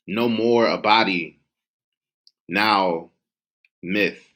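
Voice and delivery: measured, rhythmic poetry performance; spoken word poem. Acted. Poet reciting to a live audience, rhythmic spoken word. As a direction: give each image its own weight.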